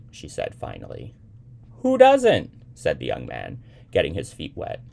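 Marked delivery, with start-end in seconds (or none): 1.8-2.5 high, loud exclamation; emphatic agreement. A narrator's reading. Young man agreeing with little Sybil, emphatic. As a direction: toss it back loud and high.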